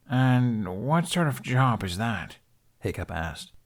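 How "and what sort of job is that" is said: slow, doubting question; skeptical. Acted. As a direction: question her with open doubt. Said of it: "The skepticism would not leave".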